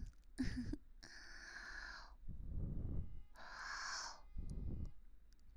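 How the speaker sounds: breathy high giggle, drawn out; long whispered giggle. Real, not acted. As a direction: giggle softly for a while. Long giggle between curses.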